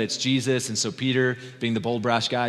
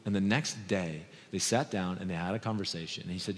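ordinary talk, nothing marked

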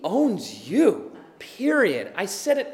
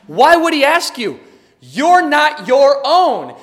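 loud, high and slow, building to a cry; worked-up preaching; 6 semitones higher, 11 dB louder, slower than usual. Real, not acted. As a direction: pound each phrase out, fervent and rising. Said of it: "I know I'm getting a little worked up".

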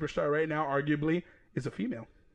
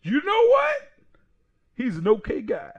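mock quote; 7 semitones higher, 6 dB louder than usual. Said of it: "He was like".

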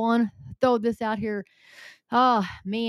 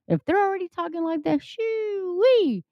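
excited rise; 10 semitones higher than usual. Real, not acted. Repeats phrase, rising to exclamation.

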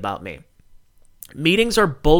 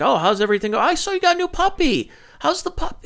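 much higher mock-friendly coworker chatter; mock coworker small talk; 11 semitones higher than usual. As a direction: parody the fake-cheerful meeting small talk, pitched way up. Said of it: "The first ten minutes of the meeting are like".

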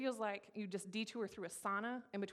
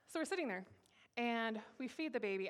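ordinary delivery

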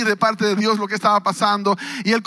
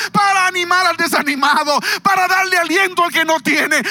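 preaching at full force; 5 semitones higher than usual. Acted. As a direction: preach it out, building to shouts. (predicación a todo pulmón, cada vez más alta)

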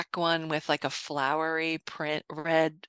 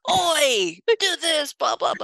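mock yelling; 9 semitones higher, 4 dB louder than usual. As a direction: imitate a bossy yell, pitched high. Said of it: "Phelps just likes to yell at people".

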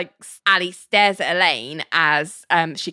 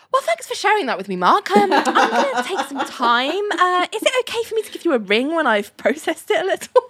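fast, much higher flustered babble; flustered rush; 10 semitones higher than usual. Retold or imitated. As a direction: hurry to escape the awkward talk. [name] dodging Mark's awkward remark.

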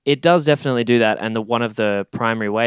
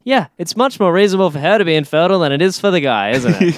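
sarcastic; 8 semitones higher, 5 dB louder than usual. Real, not acted. Sarcastic jab at film's logic.

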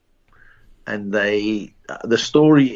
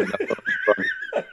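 slow, much higher, through laughter; laughing slogan; 6 dB quieter than usual. Shown as a demonstration. Repeating his braai slogan to the host, laughing. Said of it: "repeats slogan laughing".

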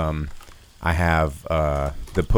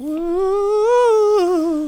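high excited woo; excited whoop; 3 dB louder than usual. Real, not acted. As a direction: let out a whoop.

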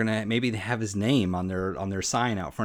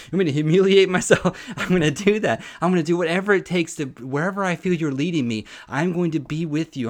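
fervent; 6 semitones higher, 6 dB louder than usual. Real, not acted. Fervent commitment to God.

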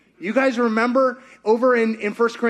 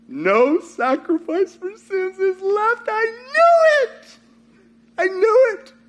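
high, drawn-out wail climbing to a peak; slow, very high mock lament; mock despair; 9 semitones higher, slower than usual. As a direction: wail it as if all is lost, hammed up. Acts out despairing believer's reaction.

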